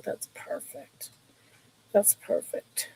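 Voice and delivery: soft unvoiced whisper. Real, not acted. Whispering an aside to viewers while selling. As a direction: breathe it out as a hushed aside.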